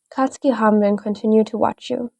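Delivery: ordinary delivery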